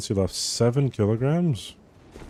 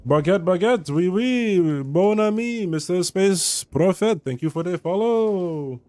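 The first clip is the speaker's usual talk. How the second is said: hammed-up French accent, pitched higher; mock French accent; 7 semitones higher, slower than usual. Thanking a follower in a silly high mock French accent. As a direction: ham up a fake French accent, slowing at the end. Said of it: "I don't know what that accent was".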